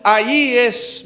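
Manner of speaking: loud, high, fervent preaching to the congregation; preaching at full force. Acted. Pastor urging the brothers on marriage, loud fervent preaching.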